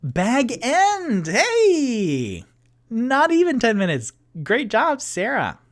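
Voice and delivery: high, loud delighted cheer at the chat; excited. Real, not acted. Streamer thanking a viewer in chat, excited and loud. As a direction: cheer the right answer. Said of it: "chat answered the question".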